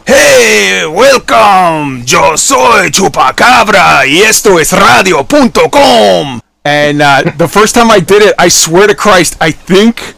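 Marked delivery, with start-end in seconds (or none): 0.1-6.4 radio DJ parody. Retold or imitated. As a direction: ham up a cheesy radio announcer. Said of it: "a parody of them where he's like".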